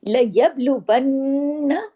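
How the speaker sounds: लयब्लुवन्ना slow with the doubled न held long in the nose; nasal ghunna demo. Shown as a demonstration. Teacher demonstrating ghunna to students, slow. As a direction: hold the न of वन्ना long and nasal. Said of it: "यहाँ गुन्ना करना है".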